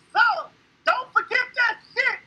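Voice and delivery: loud, high-pitched proud declaration; big, high, emphatic boast; triumphant shout. Real, not acted. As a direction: shout it out to close a victory speech. Triumphant sign-off after thanking supporters.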